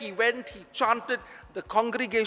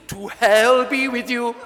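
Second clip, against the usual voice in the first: angry blurt; 6 dB louder than usual. Retold or imitated. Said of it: "he blurted out, because he was so angry".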